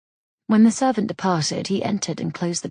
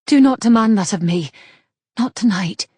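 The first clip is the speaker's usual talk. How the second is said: panicked plea; 3 semitones higher, 8 dB louder than usual. Acted. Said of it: "Her voice was panicked".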